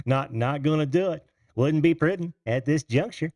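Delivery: hammed-up stuffy statesman impression; a hint of a pompous politician; horrible impersonation. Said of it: "what horrible, uh, impersonation that was of".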